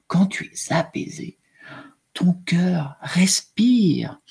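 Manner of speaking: soft, hushed storybook reading with whispered lines; story reading to children. A narrator's reading. Teacher reading the emotion book to her class, hushed and soft. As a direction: read it gently to young pupils, slowing on the calm parts.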